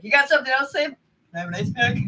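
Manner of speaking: mock angry mom, high and loud; hammed-up furious mother. Mom retold after kicking door.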